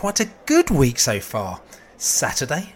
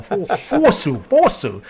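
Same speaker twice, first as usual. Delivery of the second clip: imitating commentator; 6 semitones higher, slower than usual. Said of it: "Then he started to say".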